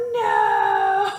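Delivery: high drawn-out slow-motion shout of no. Retold or imitated. Recalling the first kiss, a high slow-motion shouted no. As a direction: stretch the no like a slow-motion replay. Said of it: "in slow motion in my head".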